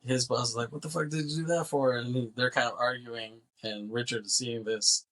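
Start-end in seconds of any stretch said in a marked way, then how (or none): 0.7-2.0 high-pitched retold outrage; mock yell. Boss scolding farmer after the shooting, mock yell. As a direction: imitate a boss telling someone off, no real volume. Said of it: "he's kinda yells at him".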